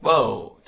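"Boat" with voiced B and a gliding ơ u vowel; model pronunciation. Shown as a demonstration. English teacher modelling "Boat" for students to repeat. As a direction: say "Boat" slowly, voicing the B. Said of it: "bờ, bầu, đúng không?".

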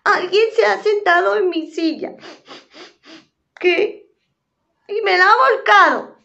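put-on high, childlike little bear voice; little bear complaining about his chair. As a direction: squeak it out like a tiny upset cub.

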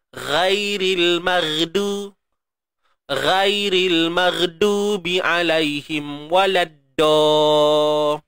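ghairil mardubi read slow with every letter thin; slow, make even thick letters thin; all-thin letters. Shown as a demonstration. Teacher showing reading with no thickness. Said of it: "tebal pun dia nipis".

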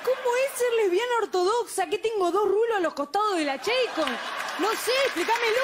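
mock incredulous. Mock reply to nosy question.

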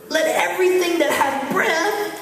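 slow build, pitch rising to a high cry; rousing scripture climax. Real, not acted. Preacher rousing the congregation with a psalm, exultant. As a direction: build steadily to an exultant finish.

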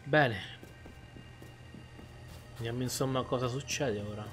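ordinary talk

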